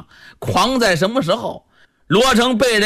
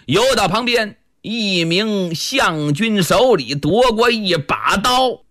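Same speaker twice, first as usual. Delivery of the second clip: usual speaking voice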